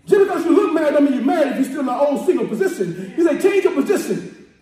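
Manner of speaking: shouted, rousing sermon cadence; preaching at full force. Real, not acted. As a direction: preach it hard to the congregation.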